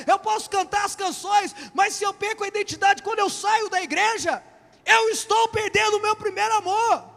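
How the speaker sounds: high, loud, shouted sermon climax; fiery preaching. Acted. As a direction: preach it hard, building to a shout. Preacher at full force.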